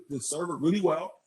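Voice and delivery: usual speaking voice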